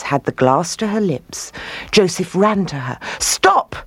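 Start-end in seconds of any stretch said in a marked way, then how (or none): 3.2-3.8 sudden high-pitched order; urgent command, pitched high. A narrator's reading. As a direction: cry out a command to halt. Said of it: "he commanded".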